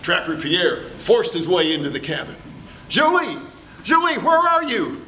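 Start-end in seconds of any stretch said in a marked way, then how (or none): 2.9-5.0 calling out. Acted. Pierre searches cabin.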